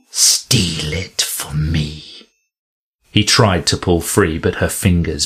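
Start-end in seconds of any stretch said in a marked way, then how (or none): 0.1-2.3 low, slow, intense command; slow fierce demand. Acted. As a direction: hold his eyes and demand it. Woman grips his arm.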